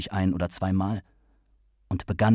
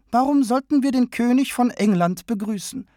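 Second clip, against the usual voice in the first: deutlich höhere, eindringliche Männerstimme; O'Connor, fragend; 14 semitones higher, 5 dB louder than usual. A narrator's reading. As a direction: fragt empört hoch nach. O'Connor-Figur, aufgebracht. (O'Connor protestiert gegen den Königsbesuch, hoch und eindringlich)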